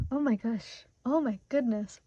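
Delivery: normal talking voice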